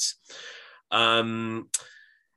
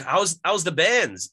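put-on Geordie accent; 7 semitones higher, 4 dB louder than usual. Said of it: "so they'll just say".

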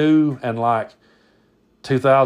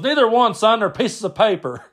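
triumphant uncle Brad; 9 semitones higher than usual. Retold or imitated. Uncle springing the trick on cousin, emphatic rebuke. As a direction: land the lesson on the dimwit, pitched up. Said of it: "hits my cousin in the face with it, goes".

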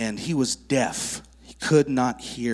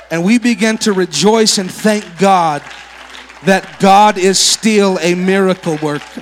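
loud, higher joyful praise; rejoicing; 6 semitones higher, 11 dB louder than usual. Real, not acted. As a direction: lift it up, praising God with full force. Preacher rejoicing over healing.

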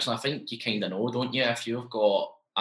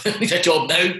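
higher and louder, laughing it out; half-laughing plea for work; laughing urgency; 9 semitones higher, 8 dB louder than usual. Retold or imitated. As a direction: blurt it with a laugh, desperate. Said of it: "I'm thinking to myself".

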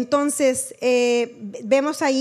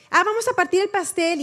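higher, lively imitation of the family; mock excitement, pitched up; chirpy party chatter; 6 semitones higher than usual. Relatives at the gathering announcing they will cut the cake, mock-excited.

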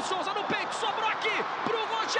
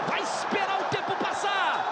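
ordinary delivery

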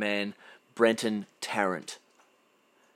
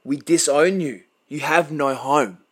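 raised, loud and a little higher, slowing on the last line; furious disowning; 4 semitones higher, 6 dB louder than usual. Real, not acted. Telling the attacker he has no home, loud and angry. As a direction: pronounce his banishment with force.

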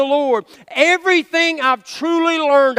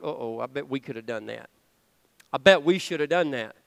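sheepish hushed uh-oh; 11 semitones lower, 10 dB quieter, faster than usual. Retold or imitated. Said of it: "I'm going".

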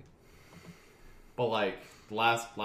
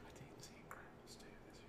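fast unvoiced whispered aside; 26 dB quieter, much faster than usual. Real, not acted. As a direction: whisper it to yourself.

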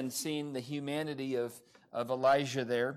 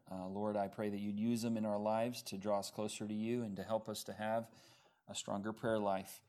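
low, soft spoken prayer; 6 semitones lower, 6 dB quieter than usual. Real, not acted. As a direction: pray quietly on behalf of the congregation. Let's have a real quick word of prayer.

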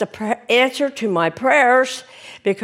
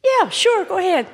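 playful yes; 10 semitones higher than usual. Real, not acted. Congregant teasing the pastor.

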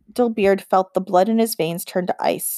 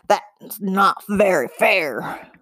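goblin voice; much slower than usual. A narrator's reading. Goblin voice in story.

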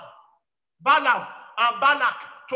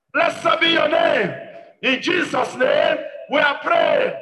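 drawn-out, slow, loud closing of a prayer; forceful prayer closing; 7 dB louder, slower than usual. Real, not acted. Preacher closing a prayer point in Jesus' name, forceful prayer. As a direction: stretch out the praise with full force.

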